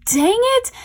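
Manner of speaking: sudden high, loud groan of disappointment; dang it. Retold or imitated. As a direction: let it burst out, pitch jumping up. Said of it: "And I was like".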